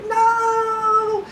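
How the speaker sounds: very high, loud hammed-up "No!"; mock desperate cry. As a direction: protest while being dragged off. Jam Jam dragged away.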